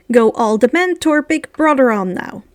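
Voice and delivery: usual speaking voice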